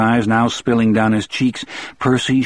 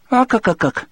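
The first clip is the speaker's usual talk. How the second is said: high cartoon chuckle; Popeye; 13 semitones higher than usual. A narrator's reading. Popeye in the cartoon book, staccato high chuckle. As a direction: chortle in staccato cartoon syllables. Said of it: "read the one over Popeye's".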